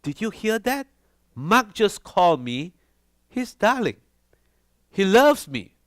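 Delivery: high, thrilled gossip to a neighbour; excited gossip. Retold or imitated. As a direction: gush the news to the neighbour. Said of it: "she says to her neighbor".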